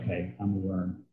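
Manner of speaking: low, very soft mock confession; humbled admission. Believer humbled before God after the passage, meek and quiet. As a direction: shrink down small and meek, dropping the volume.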